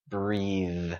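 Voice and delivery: "breathe" with every sound voiced, buzzing TH at the end. Shown as a demonstration. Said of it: "Tất cả âm trong từ này có giọng".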